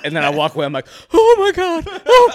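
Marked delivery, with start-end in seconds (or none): 1.1-2.3 very high, loud mock squeal; overjoyed breakdown. Actor walks away from hiring news, overjoyed breakdown. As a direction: let the hidden joy burst out, squealing.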